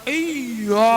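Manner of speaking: exaggerated, drawn-out push on "Iya"; overdone stress. Shown as a demonstration. Teacher warning against too much emphasis, "Iya" pushed too hard. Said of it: "tapi jangan terlalu".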